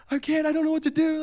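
put-on high-pitched helpless kid's cry; mock panic. Boy on runaway bike to shouting onlookers, mock panic.

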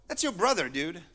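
ordinary delivery

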